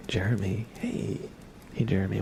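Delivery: ordinary talk, nothing marked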